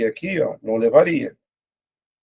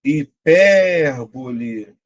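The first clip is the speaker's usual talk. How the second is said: word drawn out slowly, syllable by syllable; slow dictation; much slower than usual. Shown as a demonstration. Teacher slowly reading out a quiz word. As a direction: spell it out slowly for the class. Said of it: "Quarenta e seis".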